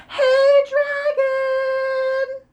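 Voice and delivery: high, very loud yell right into his ear; shout. Acted. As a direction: bellow as loud as she can. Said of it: "She shouted as loud as she could".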